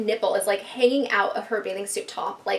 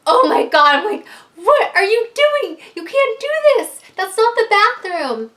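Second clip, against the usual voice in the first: horrified yelling; 10 semitones higher, 9 dB louder than usual. Retold or imitated. Narrator yelling at her drunk friend, horrified. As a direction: yell at her in horror to stop. Finding friend pooping on carpet.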